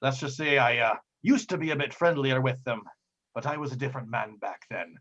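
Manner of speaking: put-on old sea captain explaining the routes; pirate captain, weighty. Captain telling his crew of three routes, weighty pirate voice. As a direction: voice a seasoned pirate, measured and emphatic.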